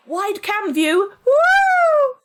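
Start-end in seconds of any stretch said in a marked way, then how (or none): 1.3-2.1 loud, high celebratory woo; excited whoop. Real, not acted. Streamer to viewers, whooping about the new camera. Unveiling the new wide cam.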